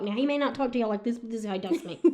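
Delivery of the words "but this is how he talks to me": ordinary delivery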